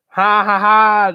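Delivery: loud, high flat "ha ha ha", clearly fake; mock the joke with a fake laugh; hammed-up sarcastic laughter. Mocking a joke he calls unfunny.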